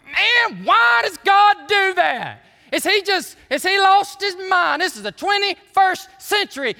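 loud, high, stretched-out complaint; mock exasperated protest. Objector telling God to lighten up, exasperated mock shouting. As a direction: shout it like a fed-up objector, hammed up. Acting out a protester against God's rules.

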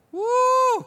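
high, loud "woo"; excited whoop. Real, not acted. Preacher reacting to Paul's bold rebuke, whooping. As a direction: let out a long, high whoop. Whoops after a fierce quotation.

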